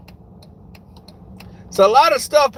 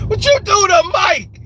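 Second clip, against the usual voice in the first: higher, louder mock scolding; mock shout; 8 semitones higher, 5 dB louder than usual. Son scolding his knocked-out mama, mock shout. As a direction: yell at her higher and louder, half laughing.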